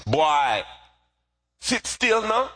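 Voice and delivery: mother's high-pitched Jamaican scolding; Jamaican accent. Retold or imitated. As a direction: order the child about in a Jamaican accent. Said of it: "in her Jamaican accent".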